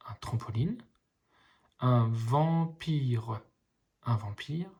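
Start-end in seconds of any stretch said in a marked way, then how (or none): 1.8-3.4 very slow, split into syllables; slowly sounding out each syllable. Shown as a demonstration. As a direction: sound out vam-pire for a child. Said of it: "les petits ponts vous aident à lire".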